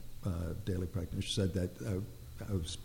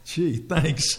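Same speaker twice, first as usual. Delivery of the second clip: mock thanks; 6 semitones higher, 6 dB louder than usual. Mock thanks for small portions.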